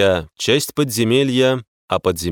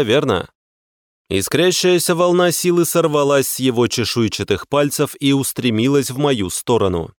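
ordinary delivery

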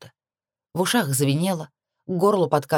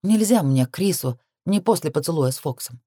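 plain reading voice

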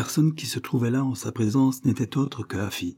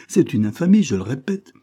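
outraged; 6 semitones higher, 6 dB louder than usual. A narrator's reading. Afy outraged, climax.

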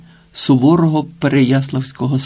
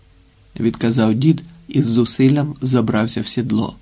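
ordinary delivery, nothing marked

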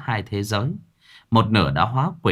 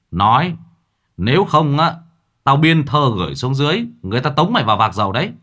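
loud threat; 5 semitones higher, 5 dB louder, faster than usual. A narrator's reading. Mạc threatens the ghost.

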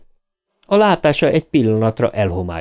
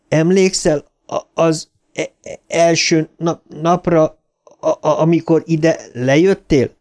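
stammering hard, pitch raised, pushing over thunder; stuttering shout; 6 semitones higher than usual. A narrator's reading. Bill shouts to Ben over the thunder, stammering. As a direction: fight through the stutter to be heard. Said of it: "ordította túl Bill a mennydörgést".

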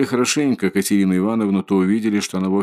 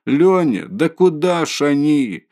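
put-on a higher, slow frightened call after the runaway children; panicked cry; 6 semitones higher, slower than usual. A narrator's reading. Mother calls after her fleeing sons in panic.

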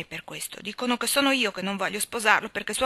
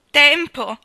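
much louder, higher scornful echo; 6 semitones higher, 11 dB louder than usual. A narrator's reading. Janet repeats Anna's word, scornfully. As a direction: spit the word back in scorn. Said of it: "con indescrivibile accento di scherno".

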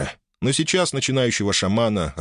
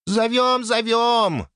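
high squeaky little spirit; much slower than usual. Acted. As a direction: squeak it like a timid sprite. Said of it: "пискнул тихоня дух земли".

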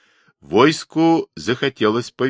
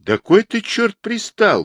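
put-on old man shouting; 4 dB louder than usual. A narrator's reading. Old man telling off the pushy ataman, shouting. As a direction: cry out in exasperation from the crowd. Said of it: "крикнул с середины согнувшийся старик".

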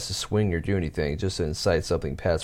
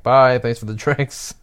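slow, raised, sarcastic send-off; mock yell back; 4 semitones higher, 6 dB louder, slower than usual. Waitress yelling back at the rude customer leaving, mock yell. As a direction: call it out cheekily after him, slow. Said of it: "I yell back".

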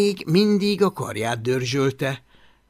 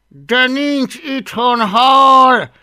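louder, higher, drawn-out exasperation; through gritted teeth; 11 semitones higher, 10 dB louder, much slower than usual. A narrator's reading. Boy repeats himself to dragon, losing patience. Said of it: "ismételgette Hablaty összeszorított fogakkal".

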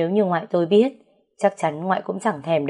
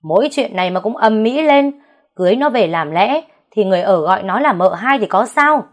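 a louder, gruff rebuke; the master barking at the household; loud scolding; 4 semitones higher, 7 dB louder than usual. Acted. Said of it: "quát to". (quát to, giọng lớn)

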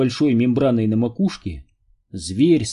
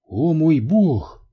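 slow, higher-pitched amazed exclamation; exclaiming; 5 semitones higher, much slower than usual. A narrator's reading. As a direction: draw it out in astonishment. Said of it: "воскликнула Нелл". (изумлённое восклицание)

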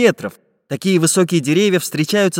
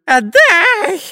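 very high, loud screech; inhuman scream; 6 dB louder than usual. A narrator's reading. Spy Dyrka demanding the chest, screaming inhumanly. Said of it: "нечеловеческим голосом закричал шпион Дырка".

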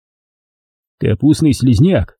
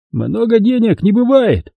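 reasoning drone; 11 semitones higher than usual. A narrator's reading. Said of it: "рассудительно прогудел Ухов".

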